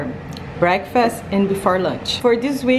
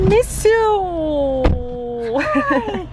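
excited; 10 semitones higher, much slower than usual. Real, not acted. Greeting partner.

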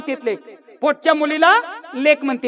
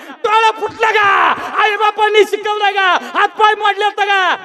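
high, hammed-up scolding; mother-in-law yelling; 3 semitones higher than usual. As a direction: berate the daughter-in-law in stretched, raised bursts. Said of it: "म्हातारी बोंबालीच बघा सुनेला". (सुनेवर ओरडणारी सासू)

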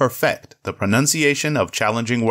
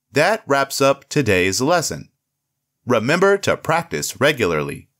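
usual speaking voice

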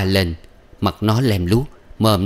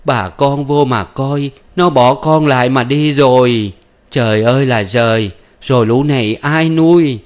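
raised, wailing public complaint; loud lamenting; 5 semitones higher, 5 dB louder than usual. A narrator's reading. Grandmother on the doorstep rousing neighbours, loudly wailing. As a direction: cry it out to the neighbours. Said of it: "đứng ở đầu thềm lu loa".

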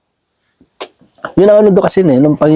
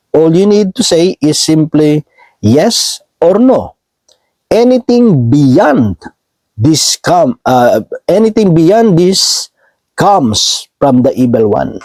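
slow, weighted verse reading; scripture reading; 2 semitones higher, much slower than usual. Acted. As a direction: spell the verse out slowly, phrase by phrase. Reads English translation aloud.

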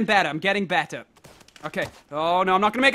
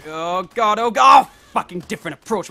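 ordinary delivery

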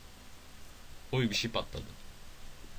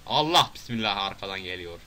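usual speaking voice

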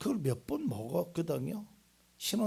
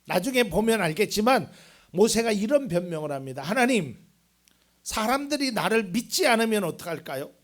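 loud, raised pulpit delivery; preaching; 6 semitones higher, 10 dB louder than usual. Real, not acted. Preacher recounts Moses' excuses to God, preaching loudly. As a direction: proclaim it forcefully to the room.

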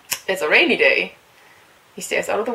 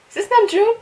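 much higher, loud surprised question; surprised excitement; 13 semitones higher, 7 dB louder than usual. Real, not acted. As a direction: ask it startled and thrilled. Fan spots member.

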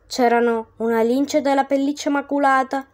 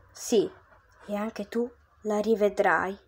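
low, soft promise; reassuring; 4 semitones lower, 8 dB quieter than usual. Acted. Virgil comforting Dante, soft and low. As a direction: gently promise him.

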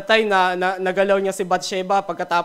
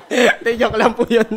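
laughing apology; 3 semitones higher, 7 dB louder than usual. Real, not acted. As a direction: take it back through giggles. Apologising while still laughing.

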